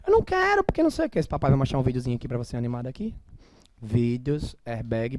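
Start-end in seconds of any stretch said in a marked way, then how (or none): 0.1-1.2 put-on high whiny child voice; imitating toddler. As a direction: act out a stubborn little kid refusing. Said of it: "ela explicando as coisas".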